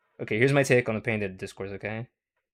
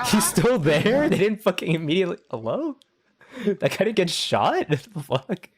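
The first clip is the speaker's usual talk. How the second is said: much higher, louder, stretched disbelief; shocked disbelief; 8 semitones higher, 4 dB louder than usual. Real, not acted. Streamer watching a clip of the president harassed, loud high shock.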